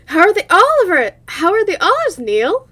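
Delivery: loud, high and eager, almost shouting; loud eager question. Acted. Eagerly asking Neil about the olives, loud and high. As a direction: demand it loudly with glee.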